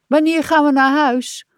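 high-pitched plaintive moan; worn-out little whine; weary groaning. A narrator's reading. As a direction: moan the question, tired of it all. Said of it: "steunde hij".

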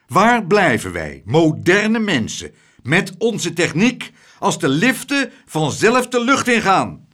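indignant rant. Acted. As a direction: rage at the magic lift. Tump's indignant outburst.

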